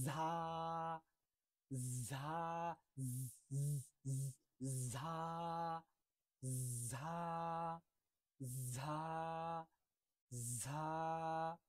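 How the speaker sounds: ঝা drawn out, demonstrated for students; Arabic letter jha. Shown as a demonstration. Quran teacher drilling students on the letter ঝা. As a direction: say ঝা slowly and clearly, repeating. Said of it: "ঝা উচ্চারণ করার ক্ষেত্রে".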